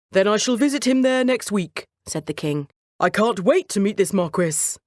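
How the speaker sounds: lightly voiced regal king, pleased; eager king. King planning to visit the Marquis, eager. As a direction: promise the visit grandly.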